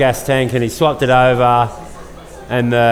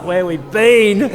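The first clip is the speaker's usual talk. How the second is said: much higher, slow disbelieving repeat; incredulous echo; 8 semitones higher, slower than usual. Retold or imitated. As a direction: throw the question back in disbelief. Retold disbelief at friend's question.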